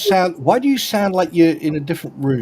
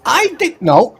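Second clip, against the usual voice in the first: normal talking voice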